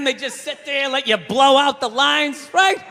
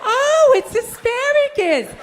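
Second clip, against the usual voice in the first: vapid brunch friends; 7 semitones higher, slower than usual. Retold or imitated.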